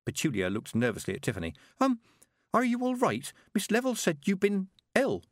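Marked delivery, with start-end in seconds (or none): 1.8-5.2 put-on nervous young girl. A narrator's reading. Petulia voiced in story.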